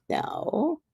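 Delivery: high, soft drawn-back refusal; light high voice for the spirit; spirit's reply. Retold or imitated. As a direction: imitate a spirit gently refusing, pitched high.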